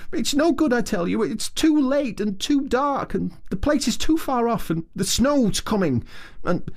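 high small voice, anxious and pleading; distressed Mole. A narrator's reading. Mole protesting it's too late and dark, anxious. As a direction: talk him out of it, fretting.

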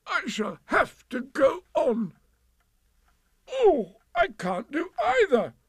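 high, slow, effortful wail; stuck bear, high-pitched and labouring; straining distress. A narrator's reading. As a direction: heave the words out while struggling. Pooh struggling, stuck.

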